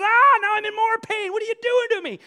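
loud, very high yell of pain and protest; mock agonized bear. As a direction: yell as the hurt bear, hammed up. Bear screams as trap tightens.

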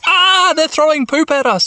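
very high, hammed-up distress; panicked wail. Mimi is pelted with poop, wailing. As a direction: squeal it high in mock horror.

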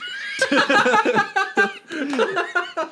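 high burst of laughter. Real, not acted. Laughs at cottage cheese pick.

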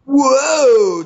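high, loud mock shout of alarm; hammed-up high yelp; startled whoa. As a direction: jump at the talking rock. Alien reacts to talking rock.

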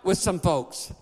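rising, shouted preacher cadence; a pastor rallying the church against the devil, preaching hard; full-force preaching. Acted.

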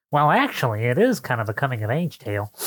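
a voice at the back of the head; small inner voice. Retold or imitated. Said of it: "that small voice in the back of your head just, it just kinda went".

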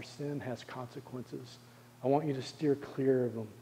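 soft, pleading Jesus speaking to a beloved son; tender, soft Jesus voice. Acted. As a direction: hush it down and plead gently with your child. Jesus voiced tenderly to healed man.

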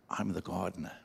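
put-on low, soft old man's reply; smiling old man. Old man leaning in to David, smiling and quiet. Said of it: "smiled, and said".

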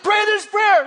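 high, loud urgent command; retold shout. Speaker tells man in the sand to pray, urgent shouting. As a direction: order it at a high-pitched yell. Retold yelled exchange continues.

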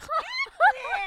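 high, loud cackling shriek; shrieking laugh. Real, not acted. Granny laughing after fooling Barb, high shrieking. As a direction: burst into a screaming laugh.

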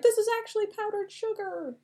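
high-pitched character voice; play-acted high-voiced realisation; mock discovery voice. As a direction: pipe it up in a silly high register.

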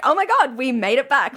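high, loud mock amazement; mock surprise. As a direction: imitate an overexcited, amazed podcast intro. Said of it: "So much surprise in every intro".

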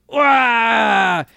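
high, loud mock yell; imitated yell. Retelling Kars melting in lava, loud mock yell. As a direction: belt out a silly cartoon scream. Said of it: "and he goes".

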